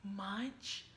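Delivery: ordinary delivery, nothing marked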